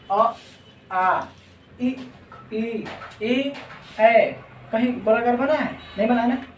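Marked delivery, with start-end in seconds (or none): 0.1-4.3 अ आ इ ई ए ऐ sounded one by one, lips unrounded; vowel demo. Shown as a demonstration. Teacher sounding unrounded vowels for the class. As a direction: spell out each vowel slowly, lips spread. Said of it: "example के तौर पर देख लीजिए".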